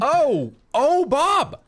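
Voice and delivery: loud high startled yelp; startled shout. Real, not acted. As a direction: yelp in sudden shock. Startled by explosion.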